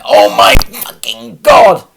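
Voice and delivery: high, loud, breathy cry of frustration; exasperated scream. Retold or imitated. Venting about endless DVLA runarounds, screaming in exasperation. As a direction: scream it out in despair. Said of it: "And it's like".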